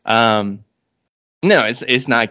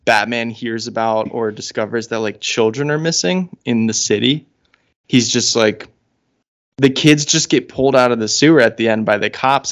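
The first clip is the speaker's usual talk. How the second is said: usual speaking voice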